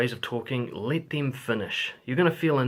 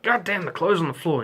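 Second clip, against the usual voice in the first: a louder, higher burst of annoyance at the clothes; mock annoyed outburst; 6 semitones higher, 5 dB louder than usual. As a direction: blurt it out, irritated. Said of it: "You're like".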